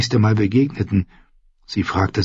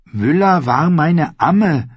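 slow, higher, emphatic declaration; solemn; 8 semitones higher, 3 dB louder, slower than usual. Acted. Ned repeats his claim to doubting Arya, solemnly. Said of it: "wiederholte er feierlich".

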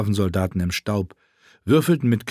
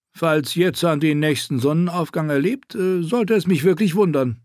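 a higher, wry dwarf voice; dry and sardonic, pitched up; lightly voiced dwarf; 7 semitones higher than usual. A narrator's reading. Tyrion tells Hela their master is dying, wry.

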